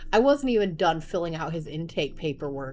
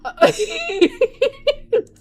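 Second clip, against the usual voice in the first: loud high cackling laugh; high burst of laughter; 10 dB louder than usual. Real, not acted. Mom laughing at her own cluelessness, loud and high. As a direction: laugh hard and high at yourself.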